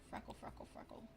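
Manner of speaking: whispered chant of freckle. Real, not acted. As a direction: whisper it to yourself. Whispered while adding.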